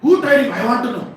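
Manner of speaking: high-pitched outraged shout; Balram. Acted. Elder brother demands to know who bound Krishna, shouting. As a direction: thunder each question, incredulous and insistent. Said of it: "Like that he's shouting".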